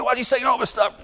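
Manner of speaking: high, pushed retold shout; mock outraged yell; exasperated shout. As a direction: throw the question up in frustration. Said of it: "I said".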